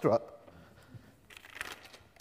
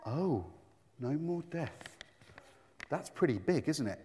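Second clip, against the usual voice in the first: hushed awe; 4 semitones lower, 10 dB quieter than usual. Acted. Awed at the last promise.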